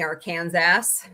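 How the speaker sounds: Arkansas said as "ar-KAN-zass"; mispronounced Arkansas. Shown as a demonstration. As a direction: rhyme the end of Arkansas with Kansas, final S sounded.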